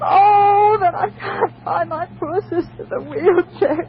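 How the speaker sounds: tearful, slowed sobbing plea; crying. Acted. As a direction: sob it out, high and slow. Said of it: "please, don't cry anymore".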